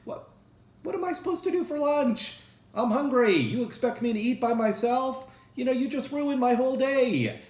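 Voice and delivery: high, whiny, indignant put-on voice; self-centred friend. Narcissistic friend griping at accident victim, high indignant whine. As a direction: whine as if your lunch matters most. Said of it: "a narcissistic person would think of only themselves".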